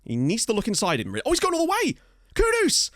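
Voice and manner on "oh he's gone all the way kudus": very high, excited match call; thrilled cry. Real, not acted. Gamer calls Kudus going all the way, thrilled cry. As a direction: cry out as the player breaks through. Calling a solo run and shot.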